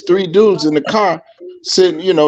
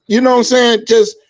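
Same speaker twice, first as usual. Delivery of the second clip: ordinary talk, nothing marked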